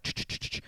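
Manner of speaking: quick run of "tsh" noises; repeated switch clicks. Retold or imitated. Recounting joke after joke landing, imitated clicks. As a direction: rattle off hissed switch sounds. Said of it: "he just went".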